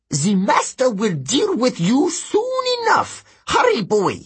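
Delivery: high-pitched demon threat; menacing demon. Acted. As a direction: threaten the intruder, then urge the boy on. Demon threatens intruder, hurries Ethan.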